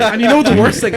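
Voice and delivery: high, loud outburst; loud exclaim. Real, not acted. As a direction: blurt it out loudly. Exclaiming about result.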